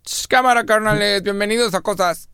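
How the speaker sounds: put-on high, singsong Mexico City accent; hammed-up chilango podcast welcome. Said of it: "hay que empezar a cambiar nuestro acento".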